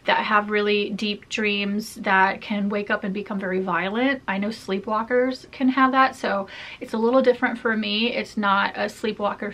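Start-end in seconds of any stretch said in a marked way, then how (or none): none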